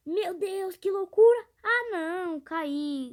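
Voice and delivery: gritando de susto. Real, not acted. Tombo de moto no jogo.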